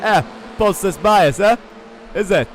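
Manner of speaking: mock South African driver, teasing; South African impression. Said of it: "That was a spot-on impression".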